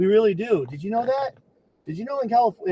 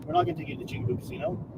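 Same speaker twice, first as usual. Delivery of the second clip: whispered aside; 8 semitones higher, 9 dB quieter, faster than usual. Real, not acted. Vlogger admits to viewers he fibbed, whispered fast. Confessing playful lie to viewers.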